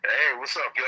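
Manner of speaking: ordinary talk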